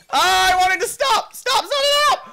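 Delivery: high-pitched panicked scream; out-of-control squealing yell. Real, not acted. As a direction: shriek for it to stop, very loud and high. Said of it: "I was screaming like a girl".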